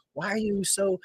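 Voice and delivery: baffled question. Retold or imitated. Movie character puzzled by the celebration, high baffled voice. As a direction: ask it high, imitating his bewilderment.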